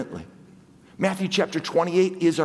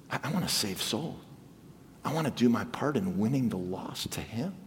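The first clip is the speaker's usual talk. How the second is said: hushed earnest; 10 semitones lower, 9 dB quieter than usual. Real, not acted. Soft, low earnest passage.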